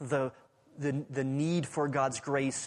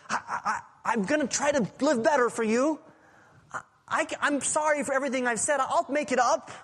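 higher, louder, stammering promises to do better; frantic pleading; 9 semitones higher, 4 dB louder, faster than usual. Retold or imitated. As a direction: plead in a rush like a desperate bargainer. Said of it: "He didn't even have the ability to say".

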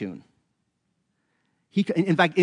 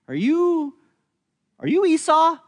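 high, strained voice of blind Isaac, searching; old father peering at a son he cannot see, higher and questioning; suspicious old Isaac; 14 semitones higher, 4 dB louder than usual. Retold or imitated. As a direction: ask it much higher, doubtful and probing.

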